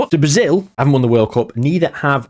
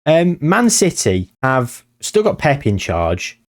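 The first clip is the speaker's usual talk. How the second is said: ordinary talk, nothing marked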